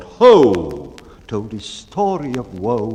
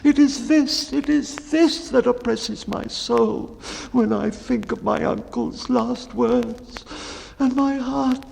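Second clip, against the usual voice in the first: high, slow, trembling dread; fearful baker; 11 semitones higher than usual. Acted. Baker confessing his dread of the boojum, high and fearful. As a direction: dwell on the horror of vanishing.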